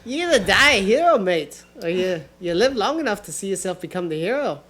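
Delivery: attempted Aussie accent, punchy start; Australian accent. Friend taking his turn at accents, trying Australian. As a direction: have a go at matey Australian. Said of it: "I was trying to do Australian".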